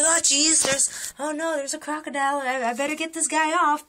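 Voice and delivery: high-pitched, fussy imitation of mild dismay; mildly bothered, not panicking. Man noticing crocodile on him, mildly bothered. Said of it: "he's not freaking out or anything".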